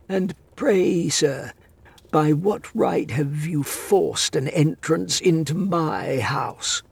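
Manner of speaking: put-on high, haughty old voice; stern old man. A narrator's reading. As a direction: demand an answer imperiously. Master demands stranger's right.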